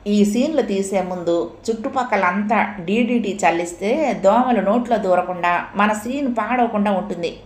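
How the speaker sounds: ordinary talk, nothing marked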